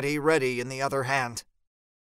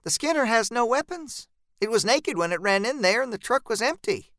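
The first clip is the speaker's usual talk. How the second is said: higher, reassuring voice; steady him with a higher calm voice; Jean reassuring Miguel in a higher voice; Jean lightly voiced higher, reassuring; 7 semitones higher than usual. A narrator's reading.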